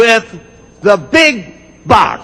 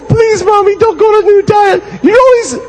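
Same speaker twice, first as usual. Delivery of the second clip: much higher, crying mock children; hammed-up tearful pleading; whining kids; 12 semitones higher than usual. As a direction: beg mommy in a sobbing little kid voice. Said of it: "And they go".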